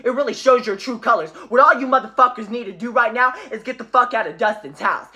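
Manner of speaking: ordinary talk, nothing marked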